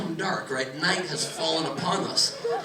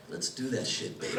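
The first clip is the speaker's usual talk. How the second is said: dad goes; 6 semitones lower, 9 dB quieter than usual. Retold or imitated. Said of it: "And he goes".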